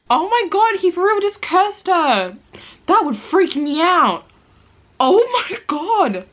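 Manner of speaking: high, loud gasping reaction; shocked disbelief. Real, not acted. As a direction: react like the twist just hit you. Shocked reacting to book twist.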